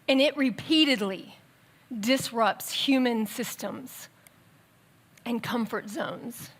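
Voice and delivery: ordinary delivery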